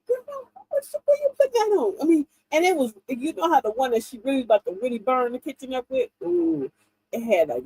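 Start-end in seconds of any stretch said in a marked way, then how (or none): 0.1-1.9 high-pitched, mock-puzzled question; questioning Grandma. Grandchild asks Grandma about her stained moo-moo, high questioning voice. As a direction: ask it high and small, in imitation.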